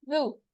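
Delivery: जू with tongue tip on the front teeth, no buzz; touch tip to tip and say जू; soft non-buzzing जू. Shown as a demonstration. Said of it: "और फिर पढ़ें".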